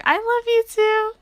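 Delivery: high, loud, imitated fangirl squeal; gushing fan reply. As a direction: squeal it back, mimicking an adoring fan. Acts out fan's reply to artist.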